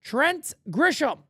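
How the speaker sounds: slow, drawn-out announcer call of the name; drawn-out ballpark intro. Acted. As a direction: stretch Trent Grisham out like a ballpark announcer. Repeats the batter's name.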